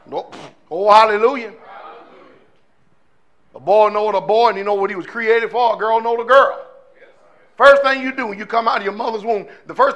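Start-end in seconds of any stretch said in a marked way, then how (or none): none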